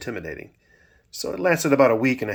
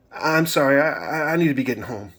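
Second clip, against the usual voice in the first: put-on higher, halting boy's excuse; flustered stammer; 5 semitones higher, 3 dB louder than usual. A narrator's reading. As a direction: stumble over an apology to get away. Dizzy boy excusing himself, stammering.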